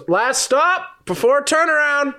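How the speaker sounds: high, drawn-out conductor's call; tram conductor. Acted. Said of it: "the conductor of the tram goes".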